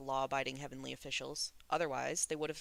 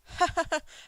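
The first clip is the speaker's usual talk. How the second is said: loud high forced laughter; nervous laugh; 13 semitones higher, 9 dB louder than usual. A narrator's reading. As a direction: cackle to hide the terror. Windmaster's frightened laughter.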